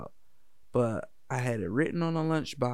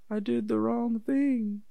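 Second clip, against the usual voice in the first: put-on much higher, slow little-kid voice, crestfallen; dejected kid; 10 semitones higher, slower than usual. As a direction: whimper it like a six-year-old who let everyone down. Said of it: "I was so disappointed".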